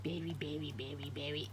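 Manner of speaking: low whispered name calling; whispering. Real, not acted. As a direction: murmur the name softly.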